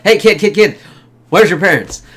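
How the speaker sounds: retold urgent hailing, high and loud; calling out to kid. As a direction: mimic someone pestering kids for attention. Retold film character hailing a kid.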